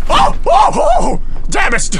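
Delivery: very high, loud yelps of shock; startled scream. Real, not acted. Player caught as monster comes through door, startled high screams. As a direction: shriek in fright, then curse.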